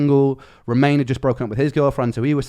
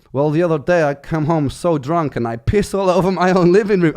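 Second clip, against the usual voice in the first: lightly put-on European accent, a bit louder; vague European accent; 5 semitones higher, 6 dB louder than usual. Drunk flatmate's story in a vague European accent. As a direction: keep the vague European accent going through the boast. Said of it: "some vague European accent".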